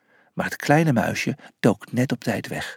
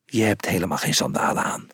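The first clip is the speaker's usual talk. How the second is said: low, softer fast growl; 7 semitones lower, 5 dB quieter, faster than usual. A narrator's reading. Miles catches Felix lying, low and fast growl. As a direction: snap it out under the breath. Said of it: "gromde Miles".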